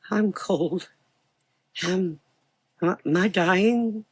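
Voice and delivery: put-on high, fading frightened boy; frightened dying boy. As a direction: ask it weakly, scared.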